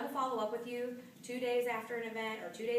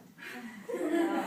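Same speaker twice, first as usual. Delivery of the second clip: amused laugh; 3 semitones higher, 6 dB louder than usual. Real, not acted. Reacts to odd request.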